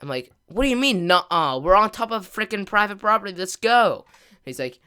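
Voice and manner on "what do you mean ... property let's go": higher, louder incredulous push to leave; mock-shout at a stubborn friend; exasperated urging. Telling Mark to get down, raised exasperated voice.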